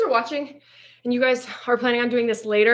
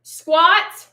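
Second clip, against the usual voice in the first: shouted command; 6 semitones higher, 7 dB louder, much slower than usual. Real, not acted. Coach calling out during workout.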